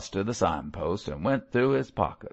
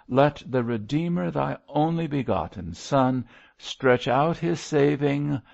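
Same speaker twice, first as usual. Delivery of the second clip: raised, solemn preacher's plea; fervent prayer; 5 semitones higher than usual. A narrator's reading. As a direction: implore God for a lost sinner. Baltic prays aloud for the sinner.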